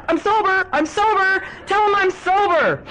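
very high, loud mock pleading shriek; insistent yelling. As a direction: yell it over and over, desperate to be heard. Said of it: "I kept going on and on".

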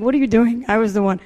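loud, incredulous retort, played for laughs; mock protest. Daughter objects to mom making amends, loud mock disbelief.

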